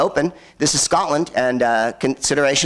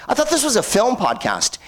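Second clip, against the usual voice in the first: lightly voiced higher-pitched heckler; mock complaint in a slightly put-on higher voice; complaining audience member; 11 semitones higher than usual. Comedian voices a listener objecting to the rambling story, mock complaint.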